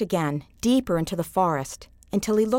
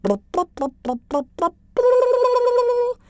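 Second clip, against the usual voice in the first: bubbly blubs rising to a high loud squeal; babble, then burst up high; the brook answering Yamo; babbling brook; 4 dB louder, slower than usual. A narrator's reading. The brook speaks.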